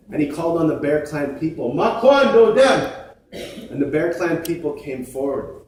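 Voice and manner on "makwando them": calling out. Acted. Creator calls on Bear Clan.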